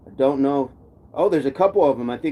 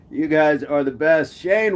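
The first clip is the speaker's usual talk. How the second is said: usual speaking voice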